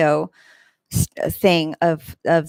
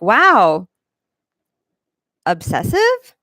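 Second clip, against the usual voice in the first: incredulous reaction; 3 semitones higher, 4 dB louder, much slower than usual. Retold or imitated. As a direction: marvel mockingly at his fixation. Her retold reaction to his comments.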